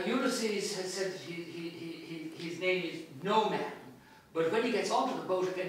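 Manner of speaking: plain reading voice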